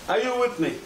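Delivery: ordinary talk, nothing marked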